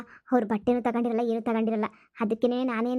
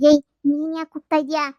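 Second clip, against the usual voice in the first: loud, raised-pitch telling-off; annoyed, louder challenge; irritated scolding; 4 semitones higher, 6 dB louder than usual. Acted. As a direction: snap at a friend for butting in. Scolding friend for copying the call.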